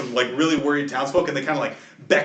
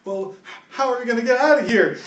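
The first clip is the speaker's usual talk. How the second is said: high, louder anxious villager; raised pitch, acted worry; worried townsfolk; 10 semitones higher, 5 dB louder than usual. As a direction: plead for a way out. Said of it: "and they, they go".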